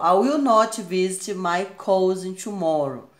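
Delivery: slow reading with will not spelled out; slow careful full-form sentence. Shown as a demonstration. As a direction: say "will not" as two clear words, slowly. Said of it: "Aqui, ó, forma contraída".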